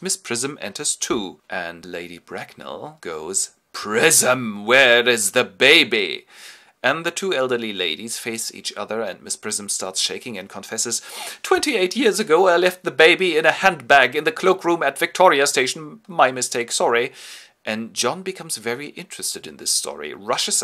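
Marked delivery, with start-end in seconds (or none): 3.7-6.3 loud, slow imperious demand; lightly voiced imperious matron; stern dowager. Lady Bracknell confronts Miss Prism. 11.4-17.1 put-on high, loud nervous old governess; hammed-up high trembling governess; mock flustered confession. Miss Prism confesses, shaking.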